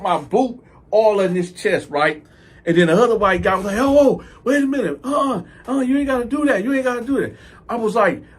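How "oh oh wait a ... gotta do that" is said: imitating a high-pitched bystander begging; alarmed pleading. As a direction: plead for him to back off. Other guy pleading in the story.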